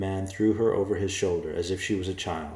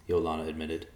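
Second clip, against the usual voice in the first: usual speaking voice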